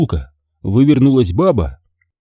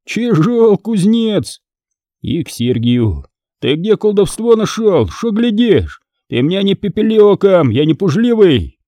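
much higher brash retort; brash young Fomka; 9 semitones higher than usual. Acted. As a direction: answer back cheekily like a brash lad. Fomka challenges monk.

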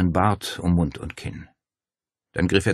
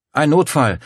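higher, louder urgent blurt; 8 semitones higher, 8 dB louder than usual. A narrator's reading. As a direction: gush it out in a rush. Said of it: "sprudelte es aus Claudia heraus".

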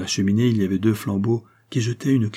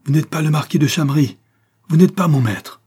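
loud, high accusation; crying out; 6 semitones higher, 7 dB louder than usual. A narrator's reading. Old servant unmasking the false marquis, crying out. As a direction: denounce the impostor with raised voice. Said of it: "s'écria le vieillard".

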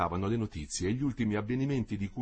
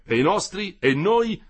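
higher, louder bitter shout; angry retort; 9 semitones higher, 8 dB louder, slower than usual. A narrator's reading. Attackers answer Olindo's plea, angry shout. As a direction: throw it back at him.

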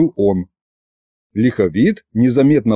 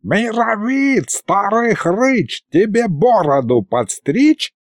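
drawn-out, higher-pitched sorcerer's call; mock yell; 9 semitones higher, slower than usual. A narrator's reading. Sorcerer chants a rhyme to the ceiling, mock yelling. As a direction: call out slowly, high, without real volume. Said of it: "заорал, подняв голову к потолку, колдун".

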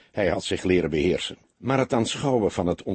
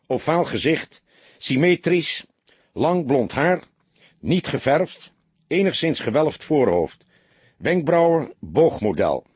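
high, raised voice in short separate items; barked-out list, pitched up; bellowed dictation; 6 semitones higher, 4 dB louder than usual. A narrator's reading. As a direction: dictate each item forcefully for note-taking. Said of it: "brulde hij".

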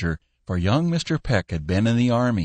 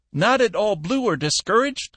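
put-on old Cappy voice; 14 semitones higher than usual. Old Cappy questioning Peck.

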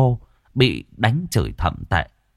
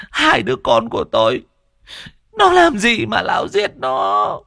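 much higher shrieking cry of rage; grieving mother's fury. A narrator's reading. Bereaved mother screams at the accused old man. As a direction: scream out grief and hatred. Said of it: "gân cổ lên".

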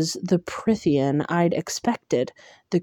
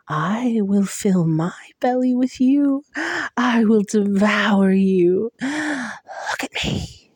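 hollow monster, slow and menacing; hungry creature voice; 3 semitones higher than usual. Acted. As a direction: savor every threat like a predator. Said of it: "hollow voice whispered".

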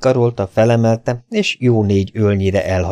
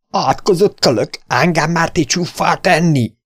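higher-pitched raging outburst; furious yell; 9 semitones higher than usual. Acted. Davy, splashed with whisky, rages at the young stranger. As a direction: spit the curse at him. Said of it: "üvöltötte vadul".

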